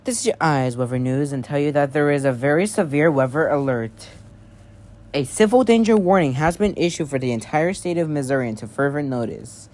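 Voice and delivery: formal emergency announcer read; news alert broadcast. Acted. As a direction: announce a severe warning to the whole state.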